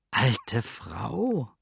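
a touch of disbelief, repeating her words; astonished echo. A narrator's reading. Sander echoes the landlady, mildly astonished. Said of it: "Wiederholte staunend Sander".

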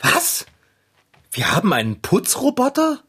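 shocked, high disbelief; surprised. Acted. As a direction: blurt it out in disbelief. Learns of a cleaning robot. (völlig überrascht)

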